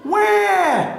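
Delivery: high, drawn-out imitated wail; baby seal cry. A narrator's reading. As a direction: call out "wah" like a baby seal. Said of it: "answered the baby seal".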